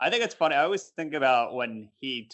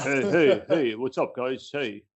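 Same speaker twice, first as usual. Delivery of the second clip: put-on thick Brooklyn accent, loud greeting; big New York guy greeting; 4 dB louder, slower than usual. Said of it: "the thick Brooklyn accent".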